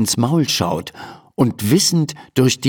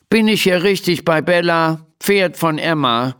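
grumpy tone; 6 semitones higher than usual. A narrator's reading. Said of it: "fragt jemand in verdrießlichem Ton".

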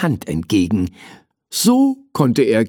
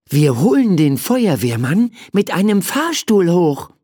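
higher-pitched, eager explanation; eager plan; 7 semitones higher than usual. Acted. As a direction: lay out the plan with a lifted voice. (eifrig erklärend)

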